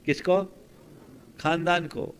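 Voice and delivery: usual speaking voice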